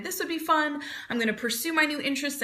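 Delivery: bright-eyed, quick resolve to try tennis; imagined eager start. Retold or imitated. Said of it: "you've decided".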